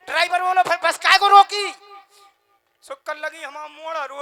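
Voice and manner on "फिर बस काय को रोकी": high, loud exasperated yell; angry driver shout. Retold or imitated. Driver shouting at the woman who won't board, furious. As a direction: yell it like a fed-up bus driver.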